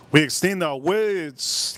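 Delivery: slow, with "extend" and "words" stretched long; drawn-out Miami words. Shown as a demonstration. Streamer demonstrating a Miami accent to chat, drawn out.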